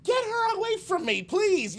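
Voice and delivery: high, loud mock panic, pushing her away; hammed-up yelp to get her off me; panicked plea. Man begs the bouncer to remove the woman, high loud panic. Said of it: "and I'm like".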